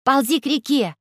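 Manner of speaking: high shouted command; Alice shouting at the robot. Acted. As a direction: order the robot to crawl to the river. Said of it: "крикнула Алиса".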